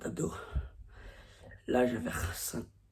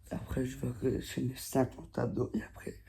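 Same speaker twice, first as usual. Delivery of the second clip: whispering; 7 dB quieter than usual. Real, not acted. Early morning hushed voice.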